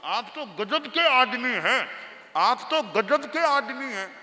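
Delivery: amazed. Acted. Disciple marvels at guru, amazed and emphatic. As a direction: exclaim in disbelief at him.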